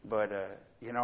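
ordinary talk, nothing marked

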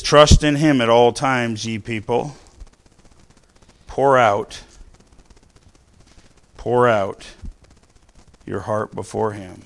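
ringing pulpit reading of a psalm; preached scripture. Acted. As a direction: proclaim it slowly to the congregation.

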